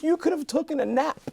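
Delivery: very high-pitched wailing imitation; hammed-up crying wife, way up high; mock tearful wife. Wife mocking her husband's dream, high mock wail. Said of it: "she broke down in tears".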